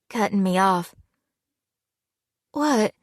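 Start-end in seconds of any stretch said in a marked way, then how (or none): 2.5-2.9 higher, thin with held-back tears; a thin, tearful what; thready. A narrator's reading. Fiancée to Hunter, thready and tearful. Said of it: "My voice was thready".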